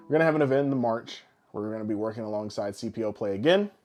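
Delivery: ordinary talk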